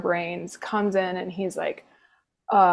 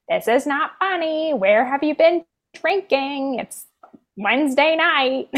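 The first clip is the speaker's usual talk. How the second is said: scolding mother; 7 semitones higher than usual. Retold or imitated.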